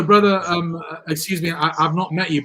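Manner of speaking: usual speaking voice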